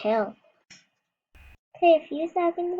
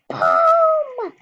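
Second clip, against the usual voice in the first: very high loud shouted boom; 14 semitones higher, 9 dB louder than usual. Pretend. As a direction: belt out the impact. Jumping off the high dive.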